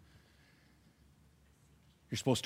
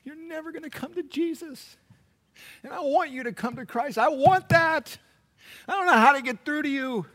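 retold tearful desperation, pitched high; choked up; 12 semitones higher than usual. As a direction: confide anguish to your wife, then let it rise. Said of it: "I was so choked up".

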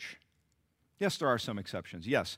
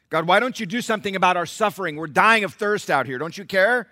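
loud, high, pleading accusation toward God; loud aggrieved outcry; complaining plea; 8 semitones higher, 11 dB louder than usual. Acted. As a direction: demand an answer from heaven. People voiced complaining to God.

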